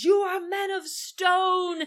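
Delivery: slow, high shouted Lear line; Lear's shout. Retold or imitated. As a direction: bellow it at the police like Lear. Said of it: "and he shouts".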